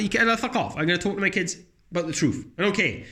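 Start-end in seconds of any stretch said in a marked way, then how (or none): none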